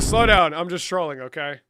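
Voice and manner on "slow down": urgent warning. Real, not acted. Reining in the chat.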